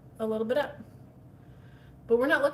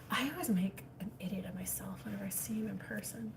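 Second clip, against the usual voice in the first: hushed whisper, much softer; 11 dB quieter than usual. Real, not acted. To viewers about the neighbor outside, whispering. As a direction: whisper so he won't hear. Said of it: "I don't know why I'm whispering".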